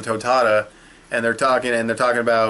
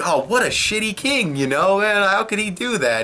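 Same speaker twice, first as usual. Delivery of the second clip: mock outrage; 9 semitones higher than usual. Reviewer voicing Doflamingo mocking the king, high fake outrage.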